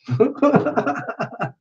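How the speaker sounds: high-pitched amused laugh. Real, not acted. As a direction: laugh at the joke. Laughing at Indian name joke.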